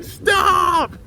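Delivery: high, loud, drawn-out yell; high mock scream; acted panicked shout. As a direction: burst in shouting to halt everything. Acted shout as they burst in.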